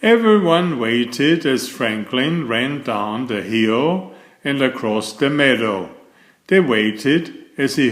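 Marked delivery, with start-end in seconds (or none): none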